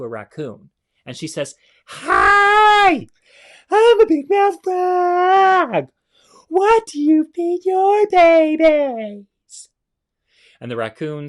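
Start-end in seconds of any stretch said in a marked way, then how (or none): 1.9-9.7 very high, very loud, slow squealing frog; big mouth frog voice. Acted. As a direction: shout it like a huge-mouthed cartoon frog. Frog character greets raccoon.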